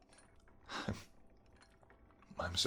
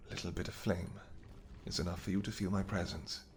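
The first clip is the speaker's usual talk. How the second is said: softer and hushed, gently reassuring; gentle reassurance; 2 semitones lower, 7 dB quieter than usual. Acted. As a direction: soothe her quietly so she isn't afraid of the fire. Calming her near the flame.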